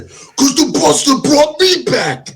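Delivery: slow, high, dramatic movie-line delivery; a mock-serious Dom line, drawn out; quoting Dom. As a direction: ham up the gravitas of the line. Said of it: "quoting movie line".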